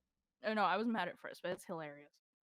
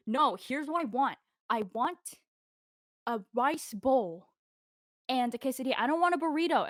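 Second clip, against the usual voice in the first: demanding customer; 2 semitones higher, 5 dB louder than usual. Retold or imitated. Customer insisting on her order to the server, demanding. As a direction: insist on each item, imitating a fed-up customer.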